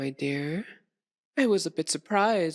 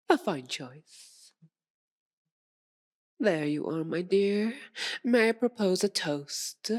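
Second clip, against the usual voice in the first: normal talking voice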